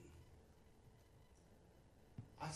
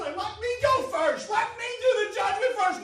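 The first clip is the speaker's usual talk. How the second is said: pleading shout; 7 semitones higher, 8 dB louder than usual. Retold or imitated. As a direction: beg loudly, drawing it out. Preachers begging at judgment.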